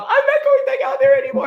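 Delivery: hammed-up despairing cry of giving up; mock wail. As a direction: daughter wails to mom after stepping off stage, loud and laughing. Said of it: "tell my mom".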